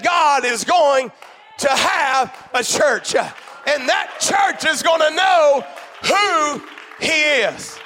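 God is going to have a church, hammered out slowly; slow emphatic preaching. Real, not acted. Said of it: "I said".

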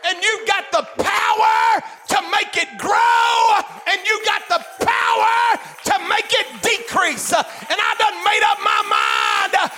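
loud, high, shouting preacher; fiery preaching. Acted. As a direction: preach it full force, building. Preaching at full force.

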